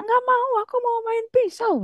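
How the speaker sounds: put-on very high, squealing little kid; mock crying child. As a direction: imitate a child's shrill protest. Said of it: "anak Anda makin nangis".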